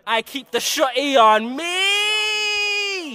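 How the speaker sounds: loud, slow, stretched-out yell of a threat; shouted threat. Real, not acted. Boasting to his friend about the shotgun, in a long loud shout. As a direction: bellow it out slowly to scare the rivals. Trading threats in a diss session.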